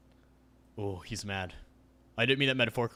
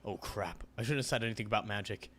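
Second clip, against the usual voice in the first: normal talking voice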